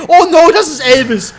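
high, very loud cry; panicked alarm. Real, not acted. Player spots the enemy Elvis, loud high panic. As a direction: shout in alarm as danger appears. Big enemy appears, low ammo.

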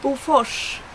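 Bofors with rs said as "sh"; Swedish Bofors. Shown as a demonstration. Teacher showing viewers the name Bofors, Swedish pronunciation. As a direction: model Bofors in Swedish, word on its own. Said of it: "words that I should, uh, pronounce".

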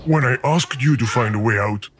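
ordinary delivery

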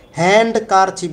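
loud demo of the wrong d. Shown as a demonstration. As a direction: stress the wrong হ্যান্ডকারচিফ with d. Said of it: "বলি না".

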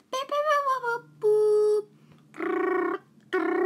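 high, loud mouth-made beeps; imitated phone dialling. Calling somebody on a toy phone, loud imitated dial tones. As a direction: beep and boop loudly like a phone.

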